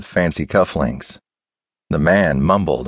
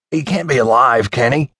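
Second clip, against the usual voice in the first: higher-pitched, anxious question; rattled, pitched-up doubt; alarmed disbelief; 6 semitones higher than usual. A narrator's reading. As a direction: ask in shocked disbelief. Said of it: "like he was going to suffer a heart attack".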